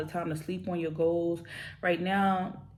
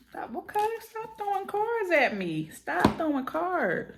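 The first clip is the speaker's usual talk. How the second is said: much higher, squeaky, playful scolding; squeaky protest; 12 semitones higher, slower than usual. Real, not acted. Tarot reader scolding the spirits for throwing cards, high squeaky protest. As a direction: squeal at the cards to stop jumping out. Cards flying out during shuffle.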